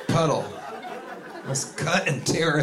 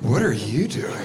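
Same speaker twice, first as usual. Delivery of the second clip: lower, calm comeback question; turning it back; 4 semitones lower than usual. Retold or imitated. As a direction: throw the question back, low and unruffled. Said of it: "I just say".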